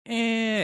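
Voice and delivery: high imitated screech; short high yelp mimicking a screamer; mock scream. Housemate charging at him, imitated screech. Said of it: "he started screaming at me".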